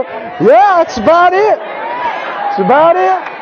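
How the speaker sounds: slow, drawn-out, high reply; slow smiling "that's about it"; drawn-out answer. Retold or imitated. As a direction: stretch it out like a happy confession. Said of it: "We go".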